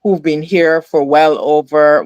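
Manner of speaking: normal talking voice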